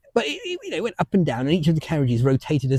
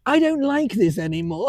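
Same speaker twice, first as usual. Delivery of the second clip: a hint of a small child, higher and slow; little girl's voice; 9 semitones higher than usual. Granddaughter on the ride wanting off, lightly voiced small child. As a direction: lightly voice a scared little girl. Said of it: "this little voice next to me say".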